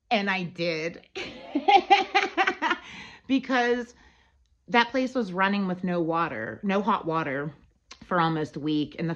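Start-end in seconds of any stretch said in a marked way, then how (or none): 1.1-3.0 real high-pitched laugh. Real, not acted. Laughs after admitting firing.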